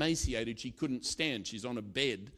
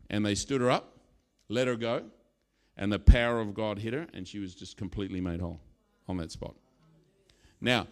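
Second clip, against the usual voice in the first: ordinary delivery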